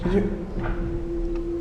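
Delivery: startled whisper. Real, not acted. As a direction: breathe it out, alarmed. Startled by a growl.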